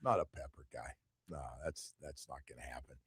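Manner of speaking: Not a pepper guy said low and dropped away; low muttered refusal. Real, not acted. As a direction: mutter the no under your breath. Refusing pepper, says it twice.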